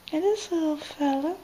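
soft, high pet talk; cooing pet talk. Real, not acted. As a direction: coo at the little bird. Hugging the bird.

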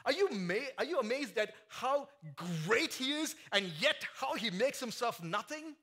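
loud, high, impassioned sermon; full force preaching. Acted. Preacher to his congregation, shouting with fervor. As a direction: lift the room with rising fervor. Climactic question.

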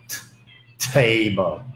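table said with a breathy aspirated t; aspirated English t. Shown as a demonstration. Teacher shows the right way to say table. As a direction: puff the t in table clearly. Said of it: "table đó là phát âm sai trong tiếng Anh".

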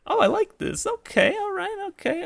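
very high, giddy little voice; pleased high voice. Real, not acted. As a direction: squeak your approval up high. Pleased by the options.

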